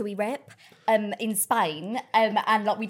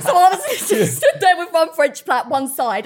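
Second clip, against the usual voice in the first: laughing through the words; high, loud, giggly telling; 8 semitones higher, 11 dB louder, slower than usual. Real, not acted. Laughing at own story.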